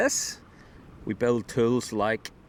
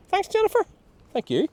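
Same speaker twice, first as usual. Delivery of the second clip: very high squeaky thanks; 5 dB louder than usual. Pretend. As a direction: squeak it out like a tiny creature.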